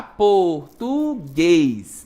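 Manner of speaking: slow "português" as POR, TU, GUÊS, each stretched; syllable separation. Shown as a demonstration. Teacher showing the word's ending to pupils, stretched syllables on "português". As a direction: draw out every syllable of "português".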